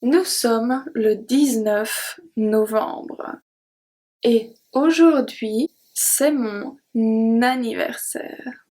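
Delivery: the whole sentence read slowly and clearly for learners. Shown as a demonstration. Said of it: "I'm going to read it one more time".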